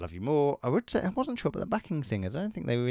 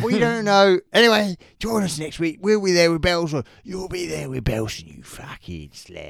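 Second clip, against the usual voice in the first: Danny Dyer impression; 5 semitones higher, 8 dB louder than usual. Cockney Danny Dyer closing the show for listeners. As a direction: belt the Cockney farewell, drop the insult. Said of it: "Really lost it here".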